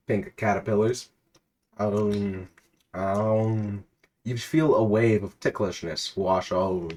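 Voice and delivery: measured storyteller read of a game scene; reading out the game's story to a friend, narrator voice; story narration. A narrator's reading. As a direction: narrate the adventure steadily.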